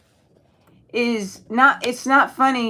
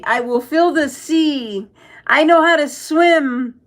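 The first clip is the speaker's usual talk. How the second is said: slow, higher put-on voice mocking a comment; 5 semitones higher, 4 dB louder, slower than usual. Streamer mocks a chat comment in a slow, higher silly voice.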